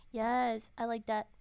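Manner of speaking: ordinary talk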